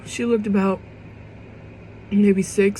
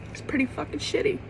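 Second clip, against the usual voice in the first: hushed repeat; 5 semitones higher, 7 dB quieter, faster than usual. Real, not acted. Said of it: "I'm not gonna lie".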